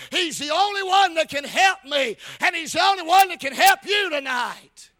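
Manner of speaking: forceful revival sermon delivery; driving, emphatic preacher voice; full-force preaching. Real, not acted. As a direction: preach it out to the whole congregation.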